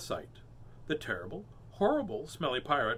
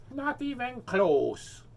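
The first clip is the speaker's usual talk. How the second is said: high, slow dismissive mermaid; high, slow eye-rolling reply; 8 semitones higher, much slower than usual. Acted. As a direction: drag it out, unimpressed, up high. Mermaid rejects answer.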